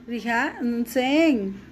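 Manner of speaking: Rija Ntseen drawn out very slowly; very slow model. Shown as a demonstration. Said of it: "pongan atención en la pronunciación". (Rija Ntseen muy lento)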